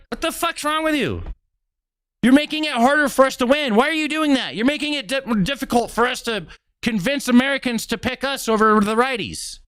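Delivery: high, exasperated mock Fetterman; a lightly voiced, frustrated Fetterman; Fetterman impression. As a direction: scold your own side like Fetterman. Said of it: "Fetterman would be like".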